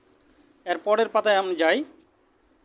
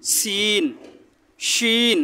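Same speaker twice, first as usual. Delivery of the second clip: ordinary talk, nothing marked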